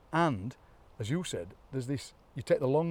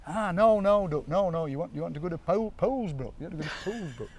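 put-on higher broad New Whittington accent; 5 semitones higher than usual. New Whittington local redirecting researchers, broad higher-pitched accent. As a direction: imitate a broad Derbyshire local. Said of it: "go to New Whittington and they talk".

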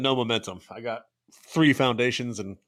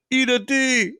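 retort; 11 semitones higher, 4 dB louder, slower than usual. Retold or imitated. Acted retort to the yelling driver.

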